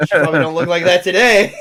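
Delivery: words squeezed out through a loud laugh; laughing hard at his own joke about the centerfold. Real, not acted. Joking about an old centerfold.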